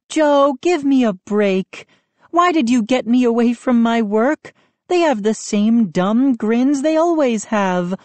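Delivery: mom fed up; mother annoyed at being called away. A narrator's reading.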